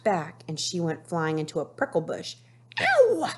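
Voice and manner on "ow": high, loud yelp of pain; scream. Acted. Nettie flying into the prickle bush, a high loud yelp. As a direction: yelp as the thorns hit. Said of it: "she screamed".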